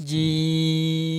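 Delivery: "জিম" with a very long, level held vowel; four-alif elongation. Shown as a demonstration. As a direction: stretch the vowel of "জিম" steadily before closing on m. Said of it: "চার আলিফ পরিমাণ টেনে পড়বেন". ("জিম" চার আলিফ টেনে)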